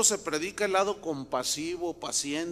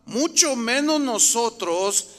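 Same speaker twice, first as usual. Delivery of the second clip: loud, emphatic Bible reading; scripture reading; 6 dB louder than usual. Acted. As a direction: proclaim the verse to the congregation. Reads scripture aloud emphatically.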